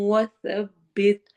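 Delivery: وثبت very slow, with an echoing bounce on the ب; tajweed demo. Shown as a demonstration. Teacher demonstrates وثبت for the class, very slowly. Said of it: "صاد کو موٹا، با پہ قلقلہ".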